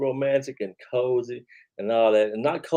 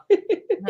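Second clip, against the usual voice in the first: a sudden squeal of laughter, much higher; high burst of laughter. Real, not acted. Host cracking up at his own joke with a high squealing laugh. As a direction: let out a sharp high laugh.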